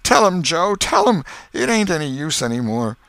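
high, loud despairing plea, sinking at the end; vanquished. A narrator's reading. Defeated Potter urging Joe to tell the crowd, despairing plea. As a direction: give up in a desperate wail. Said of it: "waved his nerveless hand with a vanquished gesture".

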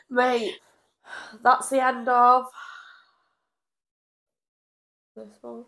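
bored and fed up, trailing away. Real, not acted. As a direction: drop it like you're sick of it. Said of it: "You sounded so fed up".

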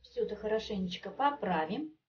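ordinary talk

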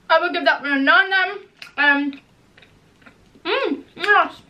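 speaking between bites; talking while chewing. Real, not acted. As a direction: mouth partly full of candy. Seen 0: Bites bar at -5; chewing motions at 0.7, 1.5; bites again 6.8.

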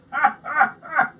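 loud high chuckle; happy laugh. A narrator's reading. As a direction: laugh contentedly. Baker laughs happily.

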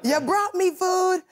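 high-pitched, stretched-out exclamation; drawn-out talk-show queen delight; Oprah impersonation. Acted. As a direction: proclaim it slowly and high, hamming it up. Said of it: "like, my Oprah impersonation".

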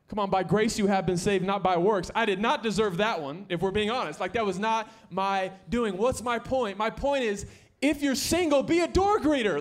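loud, high, driving sermon voice; full-force pulpit preaching; fired-up preaching. Acted. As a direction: preach it hard to the room.

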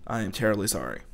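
ordinary delivery

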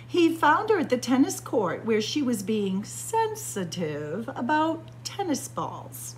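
ordinary delivery, nothing marked

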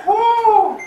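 piercing exhale from the cold; high cold gasp. Real, not acted. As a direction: blow out against icy water. In ice bath.

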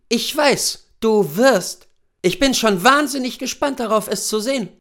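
high-pitched, keen wife; eager wife. Acted. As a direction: show your husband how thrilled you are. Voices wife eager for hunt. (hohe, gespannte Ehefrau)

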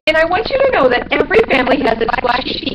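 high, fast, loud bat voice; indignant squeaky bat; defensive little bat. A narrator's reading. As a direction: rattle off an indignant excuse up high. Bat character defends himself.

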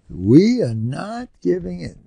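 a touch of proud defiance. Acted. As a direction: declare it like a soldier who will not yield. Voicing proud soldiers' defiant motto.

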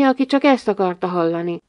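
plain reading voice

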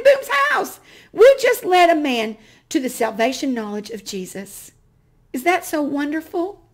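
loud, high, thrilled retelling; excited. Real, not acted. Telling the audience about the old man's prayer, excitedly. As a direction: pour out the excitement of that moment. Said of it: "and I was so excited".

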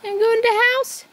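high, quick mocking echo; mimicking voice. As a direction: echo her line high and fast. Echoing the quote in same voice.

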